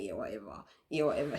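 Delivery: low, bored brush-off; dismissive teen. Retold or imitated. Kids brushing off mom's warning, low and bored. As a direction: mumble it low like a sulky teen. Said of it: "they'll be like".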